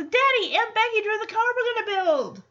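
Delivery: put-on high, eager child voice; excited little girl. Michelle showing Danny the blueprint, excited. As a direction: chirp it like a thrilled seven-year-old.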